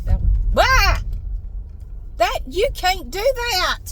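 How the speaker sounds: loud, high yelp, then drawn-out scolding; indignant driving outburst. Real, not acted. Driver telling another driver they can't do that, indignant. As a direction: cry out at a driver's bad move.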